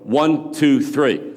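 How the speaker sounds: rising count to a shout. Shown as a demonstration. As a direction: build the count up to a yell. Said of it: "so when I say one, two, three".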